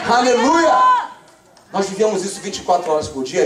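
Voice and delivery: ordinary talk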